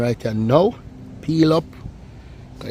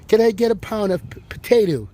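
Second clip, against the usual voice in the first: imitating a foreign, American-sounding accent; mock foreign tourist asking politely in the market; 7 semitones higher than usual. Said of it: "the woman come from foreign and she go in the market and say".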